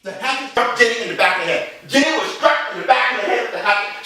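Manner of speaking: ordinary delivery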